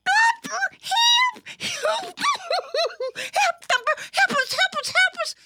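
very high, squealing little sister; panicked cry for help. A narrator's reading. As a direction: shriek repeatedly for rescue. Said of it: "cried one of the sisters".

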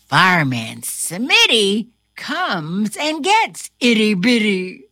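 ordinary delivery

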